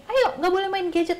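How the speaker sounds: high, loudish mock order to a child; mock-stern parental nagging, high-pitched; acted scolding parent. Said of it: "orang tua ini galak, tapi nggak tegas".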